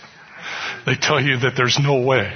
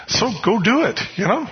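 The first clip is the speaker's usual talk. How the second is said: much higher, offhand; dismissive brush-off; 10 semitones higher than usual. Retold or imitated. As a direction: shoo him away. Dismissing friend again.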